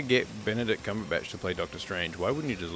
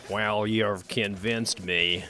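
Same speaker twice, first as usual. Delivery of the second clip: slow, drawn-out mock American accent; much slower than usual. Cumberbatch's Doctor Strange conceding, slow mock American accent. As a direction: stretch it into a hammed-up American accent. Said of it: "This is my normal accent".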